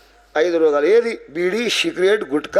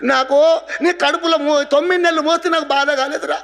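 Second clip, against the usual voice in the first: high-pitched plaintive lament; acted weeping mother; 9 semitones higher than usual. Dead mother telling her son of her pains, lamenting. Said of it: "ఎందుకు ఏడుస్తున్నావు".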